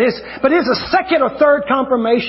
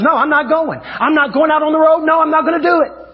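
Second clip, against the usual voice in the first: higher, insistent mock refusal; firm refusal; 5 semitones higher than usual. Preacher acting out his wife refusing to travel, emphatic and higher. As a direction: imitate someone digging their heels in.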